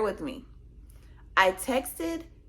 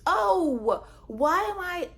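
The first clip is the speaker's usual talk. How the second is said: high, loud startled outburst; surprised; 9 semitones higher, 4 dB louder, slower than usual. Retold or imitated. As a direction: jolt up in sudden shock. Finding their DMs.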